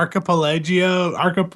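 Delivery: laughing stumble. Real, not acted. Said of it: "sound really dumb right now".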